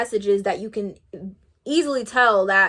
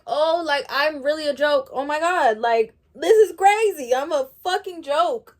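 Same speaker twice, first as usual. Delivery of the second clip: indignant disbelief; 5 semitones higher than usual. Retold or imitated. Retelling her indignant reaction.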